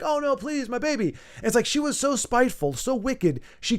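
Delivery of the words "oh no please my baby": lightly voiced pleading mother; mock pleading. A spiteful woman pretends to plead for her baby, mock pleading. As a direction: fake a mother's plea, a bit higher. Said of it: "She'd be like".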